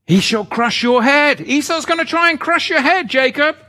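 loud, high revival preaching; fiery preaching. Acted. Preaching climax.

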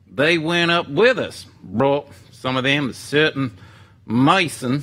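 hammed-up hillbilly Southern accent. Shown as a demonstration. Hosting viewers who asked for it, reading scripture in a hammed-up Southern accent. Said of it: "just a little southern accent".

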